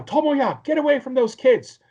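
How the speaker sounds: high, loud scolding shout; angry yell. Retold or imitated. Girl bursting in yells at Tomoya to leave the kids, loud high shout.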